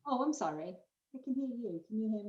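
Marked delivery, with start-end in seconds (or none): 1.1-2.3 tunnel-like muffled call audio; bluetooth headset mic. Real, not acted. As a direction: sounds far off, like in a tunnel. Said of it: "You sound like you're in a tunnel".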